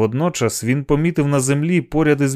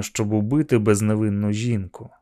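usual speaking voice

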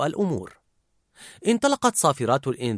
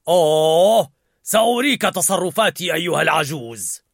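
loud, growled threat; furious Anger; 3 semitones higher, 5 dB louder than usual. A narrator's reading. Riley's Anger raging at the old man, furious. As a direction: threaten him hotly. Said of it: "بحنق".

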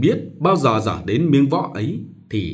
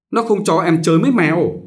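higher, louder whiny telling-on; tattling child; 6 semitones higher, 5 dB louder than usual. Acted. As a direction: tattle to mum like a spoilt kid. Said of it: "Anh được thể mách mẹ".

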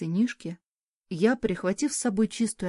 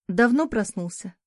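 ordinary delivery

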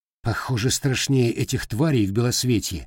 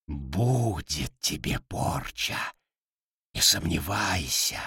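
low, soft gurgling creature voice; gurgling tentacle alien; 5 semitones lower, 5 dB quieter, slower than usual. Acted. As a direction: gurgle the words like a monster. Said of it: "пробулькала курица".

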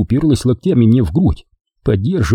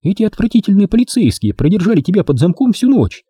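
put-on higher young woman's voice, teasing; Дженни; 10 semitones higher than usual. A narrator's reading. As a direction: play the girlfriend, sweet and teasing. Jenny voiced by narrator. (нежная игривая Дженни)

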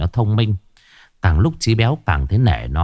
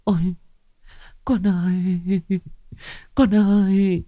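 wailing mother; 10 semitones higher, 3 dB quieter than usual. Acted. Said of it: "bà Bích tự nhiên lại rú lên".

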